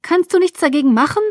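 high, fast, loud pleading; urgent plea. A narrator's reading. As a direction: beg for help in a rush. Distressed bystander pleads for help. (drängend flehend, hoch und hastig)

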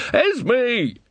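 high alarmed cry of a name; alarmed shout. A narrator's reading. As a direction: yell her name in panic. Friend faces charging unicorn.

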